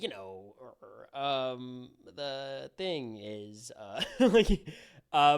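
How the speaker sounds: a light mock Tucker full of ums and stalls; halting Tucker impression. Mocking co-host's Tucker with hesitant, drawn-out pauses. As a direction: stumble through it like Tucker, pausing everywhere. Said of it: "your Tucker impression is there's not enough pauses".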